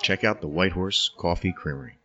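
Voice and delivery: low, soft sponsor read. Acted. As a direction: read it like a radio ad. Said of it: "I love your voice when you do those".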